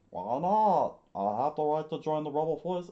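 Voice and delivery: put-on fast high defiant boy; loud high boy voice. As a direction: protest like a stubborn boy. Boy Kappa demands to join.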